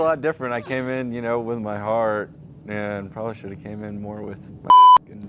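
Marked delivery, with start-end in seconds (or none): 4.6-5.1 sudden high-pitched laugh burst; loud burst of laughter. Real, not acted. Bursts out laughing mid-answer.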